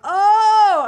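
very loud, high "ohh!"; big hollered mock-shocked "oh"; group's "ooh" reaction. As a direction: imitate guards hooting at a burn. Guards react to the joke.

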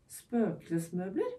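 slow, drawn-out amazed question; wondering. Acted. Cass marvels at the glowing furniture, slow amazed question. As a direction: stretch the word out in disbelief.

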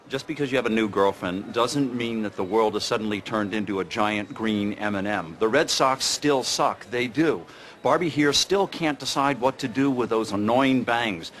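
normal talking voice

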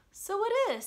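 high, bright mock surprise; a cheerful hammed-up discovery; surprised realisation. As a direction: act amazed at the obvious. Pooh realising it's his house.

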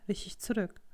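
usual speaking voice

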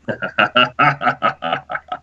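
villainous cackle; scheming old miner's evil chuckle; evil prospector laugh. Pretend. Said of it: "It's my evil prospector laugh".

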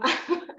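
a quick real chuckle; amused laugh. Real, not acted. Showing a mid-dance photo, amused laugh.

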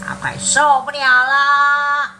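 loud, slow groan of can't-take-it; exasperated outcry. Acted. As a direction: moan that the smell is too much. Mom holding nose, can't bear it.

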